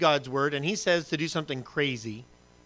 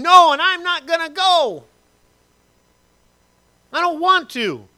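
much higher, louder defiant whine; stubborn refusal; 12 semitones higher, 9 dB louder, slower than usual. Pretend. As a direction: dig in like a stubborn child.

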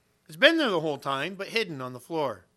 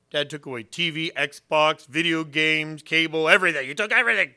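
louder whiny complaint rising at the end; upset kids complaining; 5 dB louder than usual. Retold or imitated. Angry family members complaining to the pastor, louder and aggrieved. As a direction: pile up the grievances, louder and louder.

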